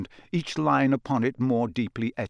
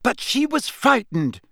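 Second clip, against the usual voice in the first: higher, louder indignant cry; angry outburst; 11 semitones higher, 4 dB louder than usual. A narrator's reading. Girl angrily defending the elf to the official. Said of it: "Hermione burst out angrily".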